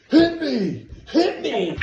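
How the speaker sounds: high, loud shouted challenge; egging on a punch at a shout; Dennis Rodman's dare. Retold or imitated. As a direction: bellow it as a dare, imitating. Acts Rodman daring him to hit.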